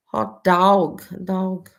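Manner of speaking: imitated New York "hot dog", vowel like "dawg"; mock New Yorker's "hot dawg"; New York accent. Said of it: "like D A W G".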